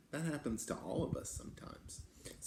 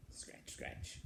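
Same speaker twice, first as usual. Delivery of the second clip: whispered sound effect; 13 dB quieter than usual. Acted. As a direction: whisper it, much softer. Quiet sound in the dark woods.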